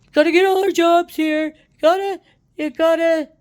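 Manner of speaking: high, loud mock Trump voice; Trump impression. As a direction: puff it up like a blustering Trump. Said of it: "paraphrasing Trump, Trump here".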